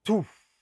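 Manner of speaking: short puffed "tuf" with the tongue striking; a sharp demo syllable "tuf", the wrong way to start the note; tongued attack demo. Shown as a demonstration. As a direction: spit out a quick tongued "tuf". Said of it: "Não faço assim, ó".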